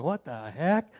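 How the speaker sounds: normal talking voice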